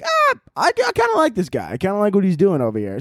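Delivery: very high mock squeal, easing down; delighted squeal. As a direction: coo over something cute in a put-on high voice. Acted delighted reaction to seeing Stitch.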